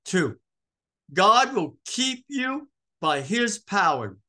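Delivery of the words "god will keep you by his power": slow, high, loud, spaced-out words; emphatic proclamation. Real, not acted. Preacher promising God's keeping power, slow and emphatic. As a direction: land every phrase with force.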